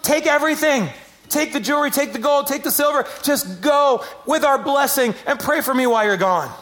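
gushing, giving up everything gladly; eager handing over. Acted. Egyptians eagerly handing riches to departing slaves, excitedly.

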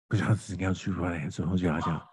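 low, garbled mumble into an ear; whispered secret. Retold or imitated. Grandson cupping Pop Pop's ear to tell a secret, garbled whispering. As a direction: imitate a child's unintelligible whispering. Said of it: "that's how it sounds".

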